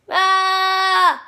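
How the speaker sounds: loud high falling "ah"; loud scream while falling. Acted. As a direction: yell as you drop. Thrown down to earth.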